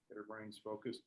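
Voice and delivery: ordinary delivery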